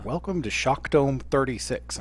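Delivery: softly spoken, deadpan announcer; Pat Summerall. Retold or imitated. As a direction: imitate dulcet, understated broadcast tones.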